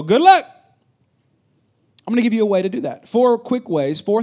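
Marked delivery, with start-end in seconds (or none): 0.0-0.4 high, loud flippant good luck; mock good luck. Typical pastor dismissing the congregation, high and loud. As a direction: toss it off like a careless pastor. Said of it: "and then you go".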